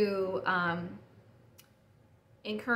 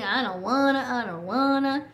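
imitating whining kids; 3 semitones higher, 5 dB louder than usual. Kids complaining when parents want something else, mock whining. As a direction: stretch it out like a complaining child, lightly mocked. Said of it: "hear them whine and complain".

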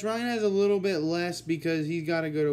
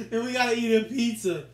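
laughing aloud through the heat; 5 semitones higher, 4 dB louder than usual. Real, not acted. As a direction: laugh while talking. Laughing at the burn.

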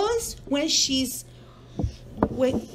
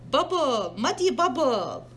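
plain reading voice

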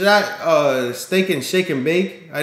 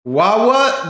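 normal talking voice

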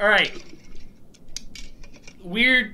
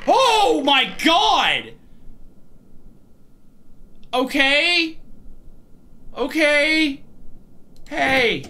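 frightened exclamation; 6 semitones higher, much slower than usual. Real, not acted. Jump scare.

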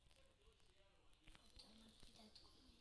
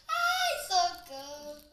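panicked cry; 3 semitones higher, 14 dB louder, much slower than usual. Real, not acted. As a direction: wail for help in fright. Caught by the Pig.

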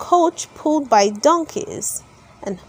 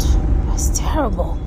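lower, whispered aside; hushed dread; 5 semitones lower than usual. A narrator's reading. Narrator telling children a sad turn, low whisper. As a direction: breathe it out like a sad secret. Narrating Pinocchio's donkey fate.

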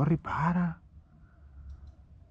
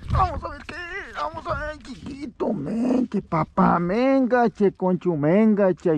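squeaky coaxing voice; 7 semitones higher, slower than usual. Real, not acted. Fisherman coos at the fish he hauls in, high and squeaky.

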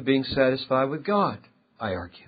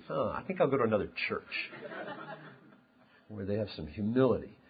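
low, much softer aside; put-off churchgoer; 7 semitones lower, 11 dB quieter, faster than usual. Acted. Congregant reacting to a boastful preacher, quietly put off. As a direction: drop to a quiet, low grumble as the imagined listener. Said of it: "you would say".

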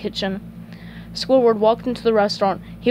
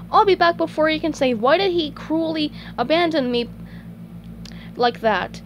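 SpongeBob joking; 7 semitones higher than usual. Acted. Silly joke to his snail at the door, high voice easing down. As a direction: start high and playful, then ease down.

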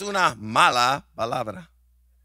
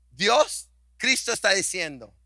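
ordinary delivery

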